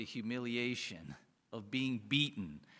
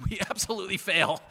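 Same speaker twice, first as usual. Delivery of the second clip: laughing it out, louder and higher; 6 semitones higher, 9 dB louder, faster than usual. Real, not acted. Pastor laughing as he admits to the congregation we fail. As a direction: chuckle through the admission that we all fail. Laughing at shared human failure.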